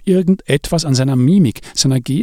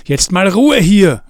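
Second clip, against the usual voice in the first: calling out; 7 semitones higher, 4 dB louder, slower than usual. A narrator's reading. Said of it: "rief er".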